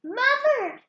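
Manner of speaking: high, loud call; shouted. Acted. Jack calling down to his mother, loud high shout. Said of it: "Jack shouted".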